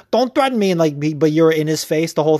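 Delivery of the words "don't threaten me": higher whiny mock of his complaint; mocking Paulie. Podcaster mocks Paulie's complaint in a higher whiny voice. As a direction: sneer it back as a mock whine. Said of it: "such a bitch move to be like".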